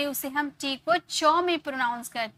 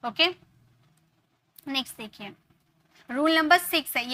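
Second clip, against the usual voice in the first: normal talking voice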